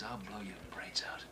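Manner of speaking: whispered threat, unvoiced and close; menacing whisper. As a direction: hiss the threat right in his ear. Cop threatens suspect in interrogation.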